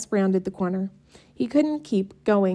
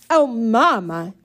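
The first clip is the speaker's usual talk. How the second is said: louder, slow whiny protest; squawking protest; 3 semitones higher, 6 dB louder, slower than usual. A narrator's reading. Sisters squawking at Mama about chores. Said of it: "squawked in concert".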